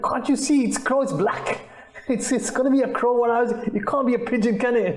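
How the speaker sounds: high, exasperated telling-off; impatient son. Retold or imitated. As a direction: snap at him like an irritated grown son. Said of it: "the child reacted like this".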